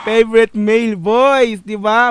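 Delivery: loud, high repeat of the praise; excited echo. Real, not acted. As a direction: proudly throw the phrase back. Echoing the judge, thrilled. (masiglang pag-ulit)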